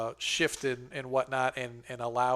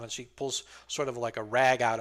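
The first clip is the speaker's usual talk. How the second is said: normal talking voice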